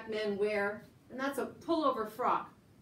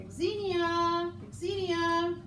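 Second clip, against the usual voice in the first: calling out; 7 semitones higher, much slower than usual. Real, not acted. Calling friend over to join.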